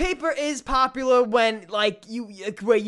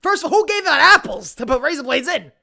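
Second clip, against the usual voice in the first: louder, higher incredulous outrage; mock outrage; 7 semitones higher, 7 dB louder than usual. Real, not acted. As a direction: rant in disbelief. Outraged rant about razor blades.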